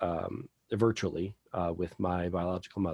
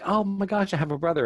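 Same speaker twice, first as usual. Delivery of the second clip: high, loud gushing over a new brother; excited; 10 semitones higher, 6 dB louder, faster than usual. Retold or imitated. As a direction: squeal it out with glee. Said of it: "She's all excited".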